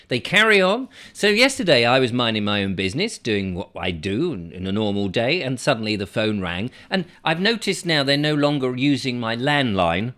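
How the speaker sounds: ordinary talk, nothing marked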